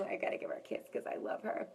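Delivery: whispered aside while she kisses someone; quick whispered aside. Real, not acted. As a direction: whisper it fast, just under the breath.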